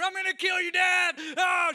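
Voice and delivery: very loud, high charging yell; screamed battle cry. Retold or imitated. Daughter charging at hidden dad, screaming battle cry. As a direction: scream it full out as an attack.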